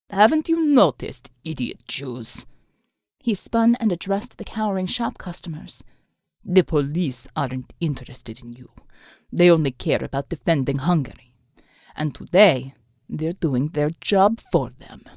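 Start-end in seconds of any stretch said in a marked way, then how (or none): 0.1-2.5 mocking villain. A narrator's reading. Villain mocks. 6.5-15.0 low cold villain. A narrator's reading. The thug tells the customers, low and cold.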